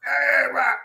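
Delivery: mock high, loud exclamation; a quick, overreacting yelp; reactive Greek outburst. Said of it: "they just like".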